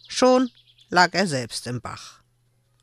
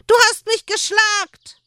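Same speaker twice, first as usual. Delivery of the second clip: put-on crying child; 6 dB louder than usual. A narrator's reading. Child still wailing.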